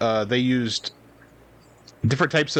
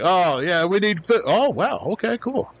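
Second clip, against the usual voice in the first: dazzled peasant; 6 semitones higher than usual. Pretend.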